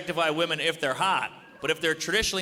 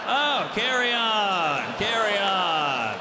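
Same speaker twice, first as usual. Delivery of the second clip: slow, louder, drawn-out easy approval; mock approval; 4 semitones higher, 3 dB louder, much slower than usual. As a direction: wave it through, unbothered. Coworker approves the poster.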